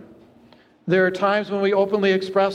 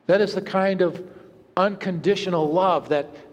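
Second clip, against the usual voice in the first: normal talking voice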